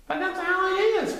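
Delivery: put-on high insistent boy voice; protesting Christopher Robin. A narrator's reading. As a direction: protest brightly. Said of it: "protested Christopher Robin".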